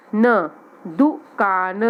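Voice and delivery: दुकान stretched very slowly, each syllable long; slow sounding out. Shown as a demonstration. Teacher to young pupils, saying दुकान slowly. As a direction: draw out दुकान for beginners. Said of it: "teacher sounds out word".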